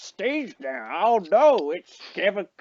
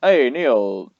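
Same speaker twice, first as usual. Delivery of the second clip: ordinary delivery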